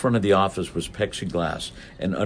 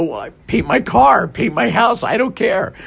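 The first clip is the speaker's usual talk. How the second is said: breezy shrug of an answer, pitched up; flippant and loud; 13 semitones higher, 5 dB louder than usual. Retold or imitated. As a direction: toss it off higher and louder, not caring.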